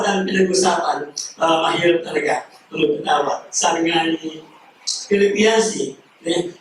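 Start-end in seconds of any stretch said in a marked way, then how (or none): none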